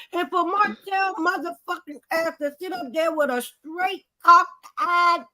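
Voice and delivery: ordinary delivery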